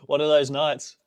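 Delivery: ordinary talk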